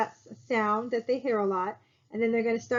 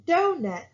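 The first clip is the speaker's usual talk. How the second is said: "donut" with more stress on the first syllable; 7 semitones higher, 3 dB louder than usual. Shown as a demonstration. Teacher showing a class stressed syllables, "donut" stressed up front. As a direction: lean on the start of "donut", less on the end. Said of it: "I'm saying more stress on the dough".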